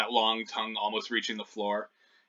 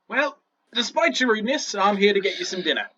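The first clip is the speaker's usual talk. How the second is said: high, brisk goblin voice; huffy goblin; 8 semitones higher, 5 dB louder than usual. Acted. Goblin jailer bringing rude prisoners dinner, high huffy voice. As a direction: announce it huffily as the goblin.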